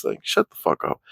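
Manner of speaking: under-breath whisper; annoyed whisper at the chat troll; whispered aside. Real, not acted.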